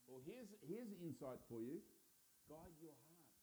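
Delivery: ordinary delivery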